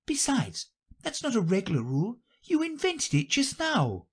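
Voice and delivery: put-on high, girlish objection; high-pitched girl standing her ground; arguing Alice. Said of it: "said Alice".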